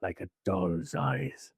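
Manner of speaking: very low, slow and haunted; Robert Shaw as Quint. Retold or imitated. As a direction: drag it out like an old sailor remembering. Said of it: "Robert Shaw character".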